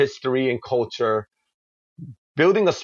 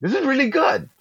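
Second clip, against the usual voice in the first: impressed exclamation; 12 semitones higher than usual. Retold or imitated. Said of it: "And he was like".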